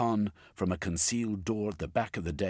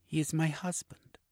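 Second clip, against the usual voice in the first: Eustacia; 11 semitones higher than usual. A narrator's reading. Eustacia answering.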